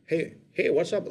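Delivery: put-on Stephen Graham's real accent. Retelling meeting the actor on set, lightly imitating his real accent. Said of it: "his accent".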